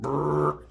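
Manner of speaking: lip-buzzed fart sound; mock fart noise. Pretending to let a big fart go, buzzed fart noise. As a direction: blow a raspberry like a fart.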